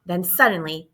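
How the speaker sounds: ordinary delivery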